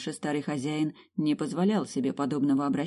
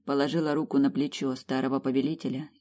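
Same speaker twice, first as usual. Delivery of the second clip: plain reading voice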